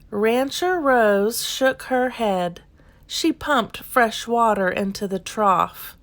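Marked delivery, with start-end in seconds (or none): none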